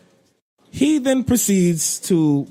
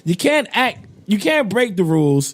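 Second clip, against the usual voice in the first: ordinary talk, nothing marked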